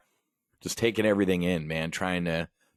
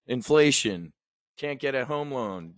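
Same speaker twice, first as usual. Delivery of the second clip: ordinary delivery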